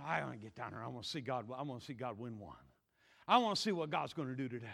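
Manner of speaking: low, quick, soft voice; hushed eagerness. Acted. David wanting to see God win, hushed eagerness. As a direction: confide it quickly under the excitement.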